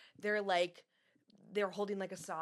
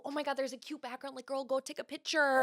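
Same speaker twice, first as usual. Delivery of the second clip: put-on higher, quicker girlfriend gush; gushing friend; 7 semitones higher than usual. One girl urging another to take a picture, gushing.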